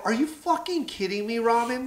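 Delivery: high-pitched disbelief; incredulous. Retold or imitated. Club comic hears star was refused a slot, incredulous. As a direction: protest in amazed disbelief.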